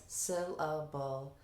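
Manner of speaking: "syllable" slowly echoed in three beats. Retold or imitated. Teacher echoing as the kids, "syllable" slow in separate parts. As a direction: repeat "syllable" part by part, drawn out. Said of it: "The kids".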